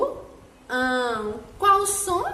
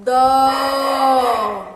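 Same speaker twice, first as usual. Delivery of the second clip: "Dó" called out loud and long; shouted syllable; 7 dB louder than usual. Shown as a demonstration. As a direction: belt out "Dó" as the class answer. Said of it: "Qual som?". ("Dó" gritado bem alto)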